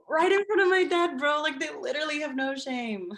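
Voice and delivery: indignant outburst; outraged. Real, not acted. Outraged telling.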